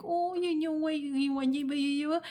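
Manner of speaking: high, cooing babble; mock people-pleaser gushing in baby talk; fawning. Demonstrating the fawning response, high gushy cooing. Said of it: "and then there's fawning".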